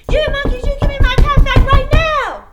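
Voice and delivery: high, full-volume yell; furious shout. Acted. Peddler stomping both feet at monkeys, furious shouting. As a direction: explode at them, demanding obedience. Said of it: "the peddler was really angry".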